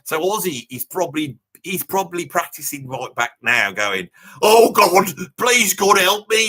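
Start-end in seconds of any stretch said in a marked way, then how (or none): 4.4-6.5 loud mock plea to God, drawn out. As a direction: cry out like a desperate rock singer begging for help. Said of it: "He's doing all that "God help me" bit".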